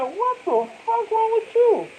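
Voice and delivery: very high, slow drawn-out squeal; mock outraged chick. Imitating the chick staring at him in disbelief. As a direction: stretch it out way up high. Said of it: "You looking at".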